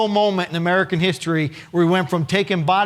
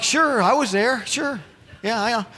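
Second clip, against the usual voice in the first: faking it; 6 semitones higher than usual. Retold or imitated. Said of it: "I'm like".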